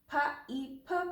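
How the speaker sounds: "Pha, E, pha" as three separate sounds; sounding out letters. Shown as a demonstration. Teacher breaking the word down, letter by letter. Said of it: "but because of magic E".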